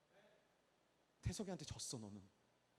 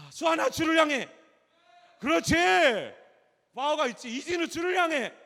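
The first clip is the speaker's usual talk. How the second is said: loud, high cheering call; rallying shout; 21 dB louder, slower than usual. Real, not acted. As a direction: roar the names like a coach. Shouting congregants forward, cheering. (이름을 외치며 힘차게 응원)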